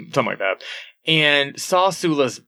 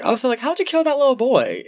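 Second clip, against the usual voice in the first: high-pitched imitation of a blunt question; retold accusation, pitched way up; mock accusing question; 10 semitones higher than usual. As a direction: put on a high voice, asking out of nowhere. Acts out Eva's sudden accusation.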